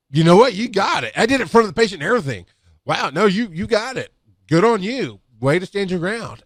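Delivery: high-pitched, admiring congratulation; impressed praise. Retold or imitated. Instructor to trainee who got the IV, impressed praise.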